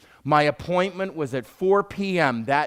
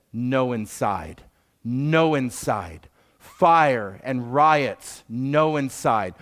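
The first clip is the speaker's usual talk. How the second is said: slow, lower, firm refusal of a driver; stubborn cab driver; 4 semitones lower, much slower than usual. Retold or imitated. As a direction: spell out the refusal slowly, no budging. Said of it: "He looks at me and says".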